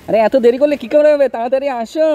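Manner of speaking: loud, high call to hurry up. Real, not acted. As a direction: shout for them to come quickly. Hurrying late friends along.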